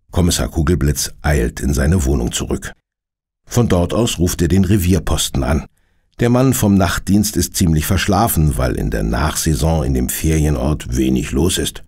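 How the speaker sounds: ordinary delivery, nothing marked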